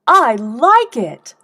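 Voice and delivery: slow, loud and eager praise; enthusiastic. Shown as a demonstration. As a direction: gush with real pleasure. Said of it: "how enthusiastically".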